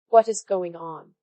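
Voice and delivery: ordinary delivery, nothing marked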